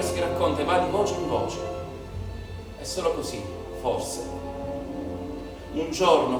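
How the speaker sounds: normal talking voice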